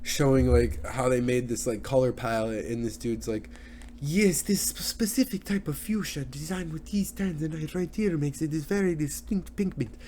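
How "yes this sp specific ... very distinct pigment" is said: put-on higher-pitched, garbled Italian accent; high-voiced guy with a botched Italian accent; muddled attempt at an Italian accent. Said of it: "I don't know what accent I'm doing".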